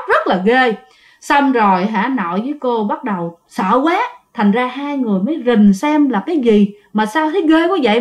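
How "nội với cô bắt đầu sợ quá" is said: ordinary delivery, nothing marked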